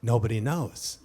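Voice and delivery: low, measured pronouncement; wise, confident. Retold or imitated. College freshman answering a pressing reporter, wise and confident. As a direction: say it low, like considered wisdom. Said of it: "I wanna sound wise, I wanna sound confident".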